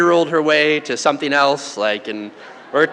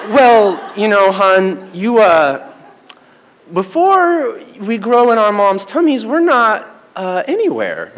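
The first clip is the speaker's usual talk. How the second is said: halting, higher retold awkward explanation; stalling; 5 semitones higher, 3 dB louder than usual. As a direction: grope for an answer with drawn-out fillers. Dad stalls for an answer.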